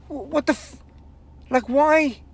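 high, stammering disbelief, then a cry of why; baffled outburst. Retold or imitated. Comic reacting to a dead crowd, baffled high disbelief. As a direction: sputter in shock at the silent room. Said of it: "And I was like".

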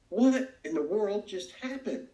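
high, slow outburst of disgust; furious disbelief. Retold or imitated. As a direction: hands up, what just happened. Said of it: "I was furious with myself".

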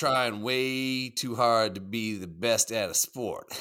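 a slowed, put-on Southern accent. Reading a viewer's chat message aloud to his friend, in a put-on Southern accent. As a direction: read the chat line in a mock Southern drawl. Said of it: "in an accent?".

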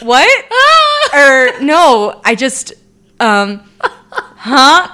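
laughing flustered. Retold or imitated. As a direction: stammer it out while cracking up. Laughing, flustered retold reaction.

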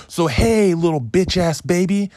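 hyped-up hollering. Real, not acted. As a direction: shout it with over-the-top glee. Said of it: "I'm a little too hyped".

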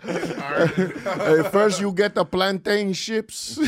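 put-on drawn-out, high-pitched Spanish accent. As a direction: play it in a slow Spanish accent, amused. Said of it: "why he has a Spanish accent".

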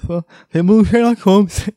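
loud laughing jab; laughing sarcasm. Real, not acted. Mocking the receptionist's guess, loud laughing.

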